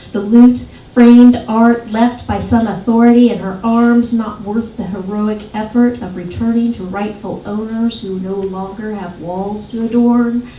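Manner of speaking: ordinary delivery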